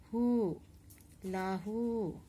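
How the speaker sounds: "hu, lahu" with the final oo held long; long vowels. Shown as a demonstration. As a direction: draw out the "u" in "hu, lahu". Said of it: "read in a little long accent".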